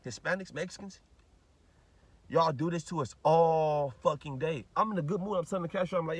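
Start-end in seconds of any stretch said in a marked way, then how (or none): 2.3-4.6 each word spaced out and hit hard; drawn-out accusing rant; slow emphatic rant. Real, not acted. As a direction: lean into every word slowly. Ranting at Mexicans, emphatic.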